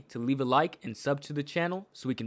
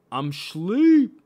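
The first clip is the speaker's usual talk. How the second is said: comic punchline; 5 semitones higher, 8 dB louder than usual. Acted. Comic punchline about boredom.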